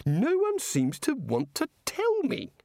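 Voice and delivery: a touch of testy petulance; irritably. A narrator's reading. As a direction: complain with mild irritation. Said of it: "said the god irritably".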